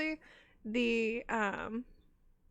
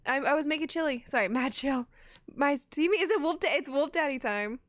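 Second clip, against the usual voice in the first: usual speaking voice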